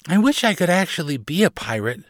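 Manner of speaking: put-on high child's voice, longing; wishful little girl. A narrator's reading. Carley alone facing the ocean, wishful. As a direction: wish for it in a young girl's voice.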